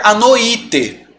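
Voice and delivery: noite drawn out as "no-Í-te"; wrong pronunciation, stressed i. Shown as a demonstration. Teacher mocking the wrong split, noite as no-Í-te. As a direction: make noite three syllables, stress on Í. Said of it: "E não é assim".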